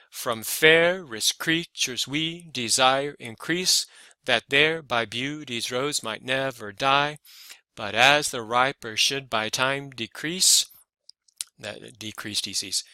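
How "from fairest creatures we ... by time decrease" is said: slow iambic beat, FAIR-est CREA-tures, every second syllable stressed; sing-song scansion. Shown as a demonstration. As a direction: chant the verse foot by foot, leaning on "fairest" and "creatures". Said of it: "you are not going to talk like this".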